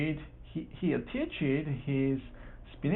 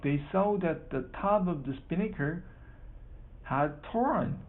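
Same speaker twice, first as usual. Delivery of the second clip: ordinary delivery, nothing marked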